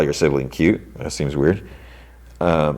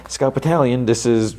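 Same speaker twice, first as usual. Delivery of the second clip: higher-pitched, stretched-out calling voice; mock radio call; 7 semitones higher than usual. [name] repeatedly calling through static, mock radio hail. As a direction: lightly imitate hailing someone over the radio.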